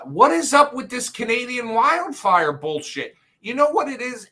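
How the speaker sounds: high, loud burst of indignation; exasperated rant. Real, not acted. Mock outrage at Canadian wildfire smoke.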